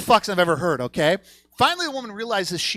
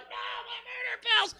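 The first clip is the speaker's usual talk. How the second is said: high, thin, softer wail of despair; mock anguished wail; 7 dB quieter than usual. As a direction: whimper high in hammed-up grief. Same wail over lost pills.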